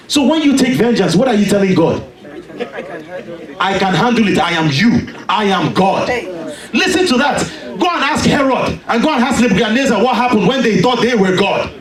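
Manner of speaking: rising, driving sermon with shouts; fiery preaching. Acted. Preacher warning the congregation against taking vengeance, shouting.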